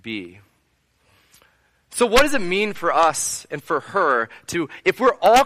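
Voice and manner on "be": much softer, lower, tired resignation; the woman at the standoff, quiet and defeated; weary plea. Retold or imitated. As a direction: let it fall away, worn out. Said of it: "she's now just at the standoff like".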